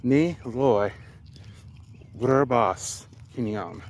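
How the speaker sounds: "Ni loy Verbas Kinyam" tried slowly, word by word; slow careful attempt. Shown as a demonstration. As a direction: feel your way through the sentence. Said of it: "let me try the sentence here".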